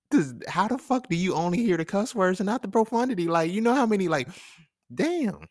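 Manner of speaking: high-pitched, amused exasperation; incredulous protest. Real, not acted. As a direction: protest in a high voice, breaking into laughs. Exasperated defence of own cussing.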